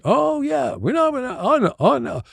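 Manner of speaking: high, playful mock Scottish accent; a lightly done Scottish local giving directions. Scottish local answering a lost American, in a mock Scottish accent. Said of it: "let me see if I can do a Scottish accent".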